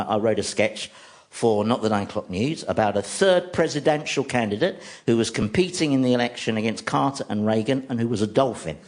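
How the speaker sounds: ordinary talk, nothing marked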